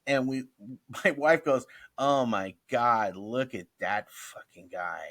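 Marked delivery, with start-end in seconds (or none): 1.9-5.0 slow, drawn-out disbelief; retold astonishment. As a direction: draw out each word, low, as the wife reacts. Retold wife's stunned reaction.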